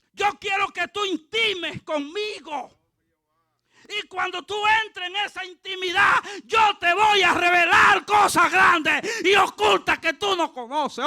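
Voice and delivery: high preacher voice building to a shout; God's forceful promise. Acted. Acts God promising revelation to Jeremiah.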